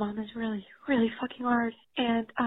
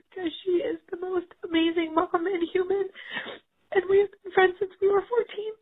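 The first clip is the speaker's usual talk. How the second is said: choking up over her best friend; crying; 5 semitones higher than usual. Real, not acted. Mom leaving a voicemail about losing her best friend, crying. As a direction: voice breaking into real tears. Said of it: "I am having a breakdown".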